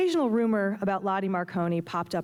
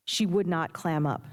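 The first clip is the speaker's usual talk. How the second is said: usual speaking voice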